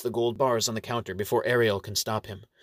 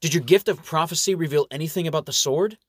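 higher-pitched, mildly anxious question; a touch of worry in a raised voice; worried; 6 semitones higher, 4 dB louder than usual. A narrator's reading. Franklin asks the Monsignor about the sword, worried. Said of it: "Benjamin Franklin gets worried".

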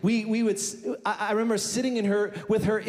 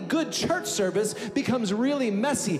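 high, ringing sermon voice building to a climax; preaching over the church music; fiery preaching; 3 semitones higher than usual. Acted. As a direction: preach it out to the congregation. Preaching at full force.

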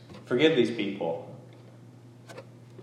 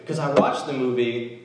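normal talking voice

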